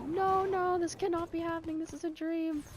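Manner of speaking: high-pitched mock distress, not shouted; freaking out. Kid in the ER waiting room, freaking out. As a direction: imitate a panicked kid in denial. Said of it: "freaking out, like".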